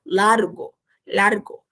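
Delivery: "Largo" with a sounded Spanish r before the g; careful Spanish "largo", r not an h; tongue-tip R. Shown as a demonstration. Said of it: "o R não importa se ele tá duplicado".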